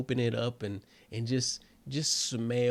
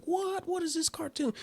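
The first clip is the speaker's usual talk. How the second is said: high, soft mock-baffled voice; squeaky imitation of a confused audience; puzzled listeners; 6 dB quieter than usual. Imitating viewers who don't know the cartoon, high-pitched mock bafflement. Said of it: "Some of y'all are like".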